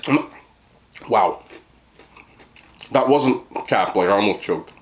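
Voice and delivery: talking through a mouthful of chicken; words round a big bite; mouth full. Real, not acted. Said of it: "Shouldn't talk with your mouth full".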